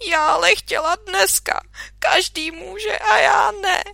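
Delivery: high, loud, crying wail; tearful outburst. Acted. Minka crying to father she cannot ski, loud wail. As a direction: wail it through sobs.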